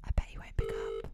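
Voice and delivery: hushed whisper so the call can't hear. Real, not acted. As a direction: whisper it under your breath. Everyone be quiet.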